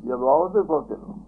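ordinary delivery

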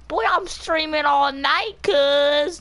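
high, slow hyped-up drawl; mock hype voice. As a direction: ham up your own boast. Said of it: "like I did that last night".

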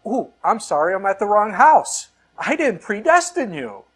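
preacher mocking the idea of predestination with a joke Jesus. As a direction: play Jesus as a sheepish wrong-house visitor. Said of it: "there's Jesus and goes".

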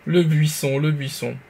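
ordinary delivery

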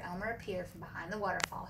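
ordinary delivery, nothing marked

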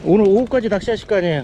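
loud, high, quick shouted question; loud, fast question to a neighbour; calling out. Real, not acted. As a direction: call the question across the water. Calling question to another angler.

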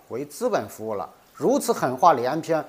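normal talking voice

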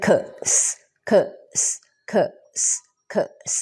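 "K" as a short "kuh", "S" as a hiss; separated K-S sounds. Shown as a demonstration. Teacher splits X into two sounds, sounded out. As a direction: sound out "K", pause, then hiss "S". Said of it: "จะเป็นเสียง K กับเสียง S".

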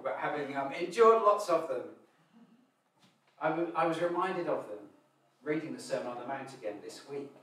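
normal talking voice

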